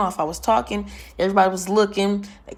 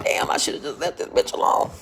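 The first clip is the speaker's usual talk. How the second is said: inner thought whisper; 4 semitones lower than usual. Retold or imitated.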